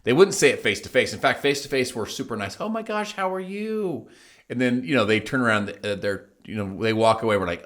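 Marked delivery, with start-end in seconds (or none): 2.6-4.1 mock sweet greeting. Fake niceness face to face.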